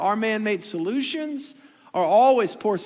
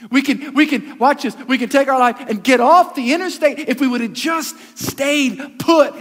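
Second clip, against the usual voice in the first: rising, louder preacher's push; preaching at full force; 3 semitones higher, 5 dB louder than usual. Acted. Pastor pressing the congregation, preaching hard. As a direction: drive it home like a pulpit preacher.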